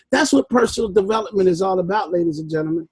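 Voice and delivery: ordinary talk, nothing marked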